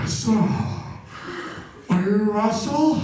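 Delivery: slow, winded questioning, imitated; mock breathless teacher voice; out-of-breath gym teacher. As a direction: puff between the words like a winded coach. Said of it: "sounded like he was out of breath".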